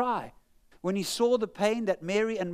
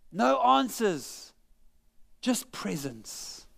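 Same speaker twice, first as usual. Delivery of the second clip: slow, hushed; much slower than usual. Real, not acted. Emphatic slow summary.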